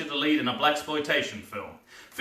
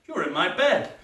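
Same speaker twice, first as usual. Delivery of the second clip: normal talking voice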